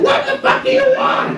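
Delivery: a cop voiced as a screaming eagle; screeching eagle officer. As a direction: screech it like an angry bird. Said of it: "He's like".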